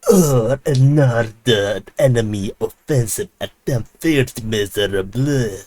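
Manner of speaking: put-on slow, sneering British villain accent; British evil person accent. Russian ministry taunting Ukrainians, slow British villain accent. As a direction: drawl it like a gloating British villain. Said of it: "British, uh, evil person accent".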